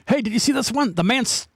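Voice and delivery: normal talking voice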